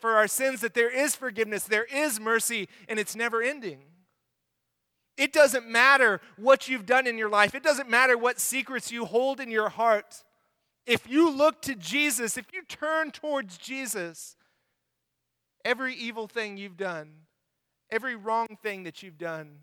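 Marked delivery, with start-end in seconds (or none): 5.2-14.4 loud, pleading preacher's appeal; fervent preaching. Acted. Pastor urging listeners to turn to Jesus, loud and fervent. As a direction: press the point home to the congregation.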